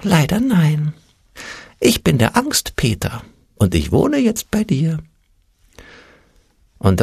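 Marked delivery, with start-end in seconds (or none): 0.0-5.0 high, thin, regretful voice; sighing little voice. A narrator's reading. Fear creature introduces itself to Ole, sighing and high. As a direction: sigh it out in a tiny high voice. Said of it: "Das Stimmchen seufzte".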